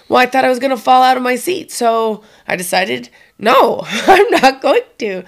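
put-on proud kid telling how she held on; daughter's voice. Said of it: "She goes".

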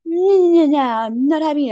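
high, loud string of noes; mock refusal. Jacob rejecting the pregnancy, mock outraged refusal. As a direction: imitate an outraged protest, stretching the noes. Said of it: "he's like".

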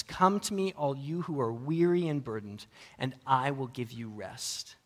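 slow, soft, fading scripture reading. Acted. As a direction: offer rest gently, slowing down. Reads Jesus' invitation in sermon.

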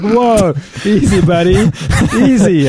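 high-pitched mock alarm, half laughing; calm down an overexcited customer, playfully startled; startled hawker. Street hawker to a shouting driver, playfully taken aback. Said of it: "And they'll be like".

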